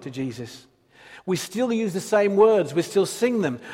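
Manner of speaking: ordinary talk, nothing marked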